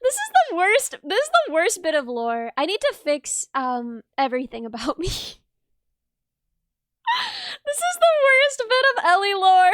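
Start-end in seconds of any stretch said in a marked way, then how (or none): 0.0-2.5 mock despair. Real, not acted. Streamer lamenting an embarrassing bit of lore, playfully wailing. 5.1-9.7 high, loud whining wail; mock despair. Real, not acted. Streamer lamenting lore about themselves, wailing in mock anguish.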